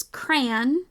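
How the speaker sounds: crayon squeezed into one syllable, rhyming with man. Shown as a demonstration. Teacher showing how she says it, one-syllable crayon. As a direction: say crayon as "cran". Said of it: "How I say it".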